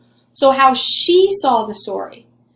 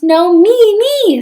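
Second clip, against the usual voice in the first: higher, louder insistent cry; competing stepsister; 9 semitones higher, 6 dB louder than usual. Acted. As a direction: push ahead of her sister. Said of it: "said the other".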